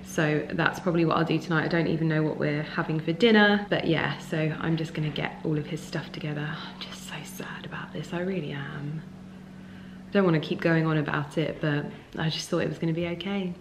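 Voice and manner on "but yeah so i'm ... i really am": sad soft whisper. Real, not acted. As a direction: whisper it, holding back sadness.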